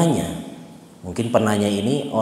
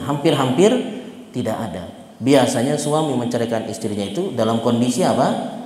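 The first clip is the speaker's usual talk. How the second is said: ordinary talk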